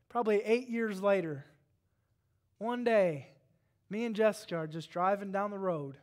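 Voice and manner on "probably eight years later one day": ordinary talk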